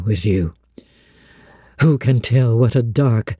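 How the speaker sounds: low, brooding confession of lonely months; deep, melancholy outpouring; Rochester, aching longing. Acted. Narrator voices Rochester.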